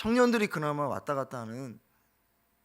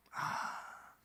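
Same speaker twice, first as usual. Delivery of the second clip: breathy whispered ah of realization; whispered gasp of understanding; whispered sigh; 14 dB quieter than usual. Acted. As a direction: let it out as a dawning whisper. Elijah's inner realization.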